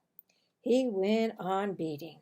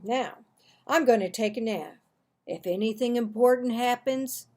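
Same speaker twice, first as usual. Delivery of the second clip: louder, firm warning; a hint of a stern father; 8 dB louder than usual. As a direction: lay down the law before the nap. Father's warning before nap.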